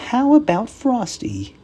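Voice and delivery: put-on high, bright young girl; little girl voice. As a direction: ask like an eager little girl. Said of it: "asked Karen".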